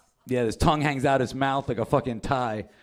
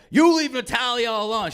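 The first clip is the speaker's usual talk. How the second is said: put-on high, louder kid challenge; mock angry confrontation; 10 semitones higher, 5 dB louder than usual. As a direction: blurt out a hammed-up warning to a rival. Kid confronts his rival.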